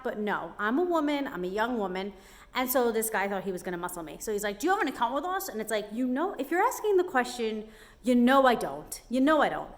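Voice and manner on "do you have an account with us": high, pushy mock salesman voice, much higher. Retelling the propane man's question, high and pushy. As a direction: mimic the pushy salesman again. Mocking the propane man again.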